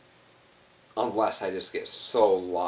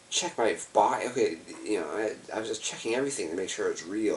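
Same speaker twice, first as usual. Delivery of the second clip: usual speaking voice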